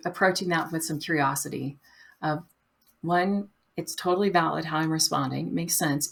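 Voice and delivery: ordinary talk, nothing marked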